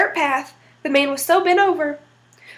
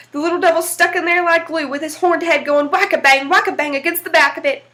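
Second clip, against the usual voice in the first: plain reading voice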